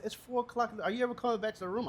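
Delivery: retold nagging wife. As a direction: voice a partner checking up, pitched high. Retold wife's nagging phone call.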